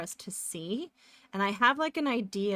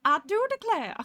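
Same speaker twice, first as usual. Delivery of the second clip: put-on higher, louder Southern belle accent; fake Southern accent; 10 semitones higher, 6 dB louder than usual. Streamer answering chat in a hammed-up Southern accent. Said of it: "putting on a fake Southern accent".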